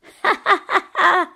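high, loud wicked cackle; crazy laugh. A narrator's reading. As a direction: burst into a mad witch's laugh. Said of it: "lachte Mona". (hohes, verrücktes Gelächter)

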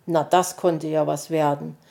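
plain reading voice

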